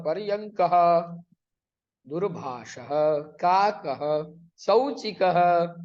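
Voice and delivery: each word slow and separate, like "कृषकः" with the visarga sounded; slow pronunciation drill. Shown as a demonstration. Teacher reading picture words slowly for students to repeat. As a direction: spell out every syllable for the class. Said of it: "इनका उच्चारण करिए".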